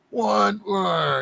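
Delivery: tough guys. Acted. Mimicking tough guys coming up.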